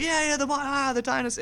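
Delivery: high yeahs of recognition; excited recognition. Retold or imitated. Travelers catching the dinosaur joke, high and excited. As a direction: get it and cheer, way up high. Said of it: "we're like".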